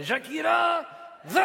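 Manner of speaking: high, drawn-out showbiz shout; star's proclamation. Acted. Puppet declaring himself the new star, shouted announcer-style. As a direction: announce yourself with long stretched syllables.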